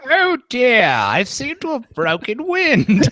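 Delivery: put-on high, slow old British lady accent; an old woman's British accent, pitched high and drawn out. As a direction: play a prim elderly Englishwoman, high and unhurried. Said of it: "do your old woman British accent".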